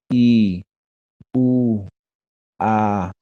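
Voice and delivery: "I, U, A", each held for two beats; lengthened vowels. Shown as a demonstration. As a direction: draw out each of "I, U, A" without breaking it. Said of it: "vamos a pronunciar en dos tiempos".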